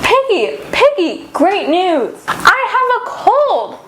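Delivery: high, thrilled calling out; elated, stretching out the last words; excited announcement. Acted. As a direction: share great news in a high storybook voice. Character runs with great news.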